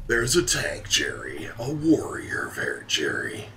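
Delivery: hammed-up mock promo voice; dungeon boss announcer. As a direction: sweep up and down on each name like a hype man. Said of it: "doing the dungeon boss announcer voice".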